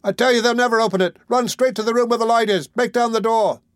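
high, urgent yelling; screaming. A narrator's reading. Boy directing the crowd outside, screaming. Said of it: "screamed the boy".

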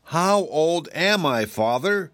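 slow, insistent repeat of the question; slow stubborn child asking again; persistent. A narrator's reading. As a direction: drag it out, won't let it go. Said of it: "persisted the small dragon".

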